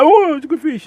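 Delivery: squealing fake excitement over a fish; mocking social media angler. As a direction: ham up an overexcited influencer. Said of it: "these social media types".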